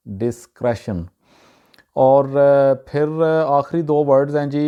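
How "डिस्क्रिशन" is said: डिस्क्रेशन with "kresh" in the middle; correct pronunciation. Shown as a demonstration. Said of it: "इसका जो सही तलफ़ुज़ है".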